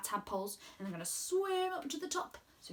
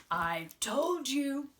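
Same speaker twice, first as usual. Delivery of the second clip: frog voice; 3 semitones higher, 3 dB louder, much slower than usual. Acted. Proud frog reply.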